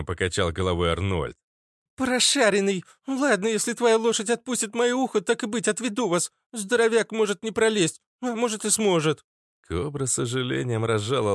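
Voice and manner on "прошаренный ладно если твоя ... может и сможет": put-on high, brisk girlish voice; feisty girl. Girl agrees to lead them, high and feisty. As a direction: bargain in a piercing little voice.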